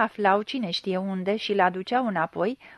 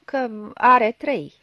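slow, louder bitter stress on trei; bitter self-reproach; 2 semitones higher, 6 dB louder, much slower than usual. A narrator's reading. Young man confessing bitterly to the blind man, slow and loud. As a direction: lash himself with each slow word. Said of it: "se biciui el singur".

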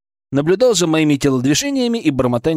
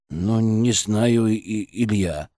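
slow, low, soft hesitation; doubtful colleague; 6 semitones lower, 5 dB quieter, much slower than usual. A narrator's reading. Colleague doubts his friend is all right, hesitant. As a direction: drag it out softly, unconvinced. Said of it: "Пончик недоверчиво почесал затылок".

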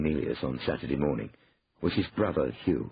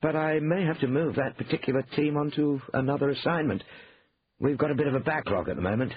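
higher-pitched brother making excuses; Hugh, evasive; 10 semitones higher than usual. A narrator's reading. Brother backs out of the kitchen job, evasive. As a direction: wriggle out of it politely.

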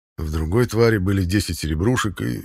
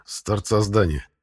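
ordinary delivery

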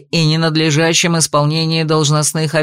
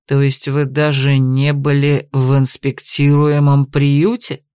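low growled question; growling; 6 semitones lower, slower than usual. Acted. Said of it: "прорычал Аршес".

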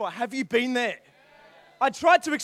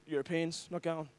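sulky grumble; 7 semitones lower, 6 dB quieter than usual. Retold or imitated. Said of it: "I was so angry".

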